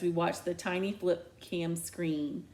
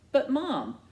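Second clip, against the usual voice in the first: higher, pleading protest; 7 semitones higher, 4 dB louder than usual. Acted. Daughter protesting against her mom's order. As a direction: whine of protest.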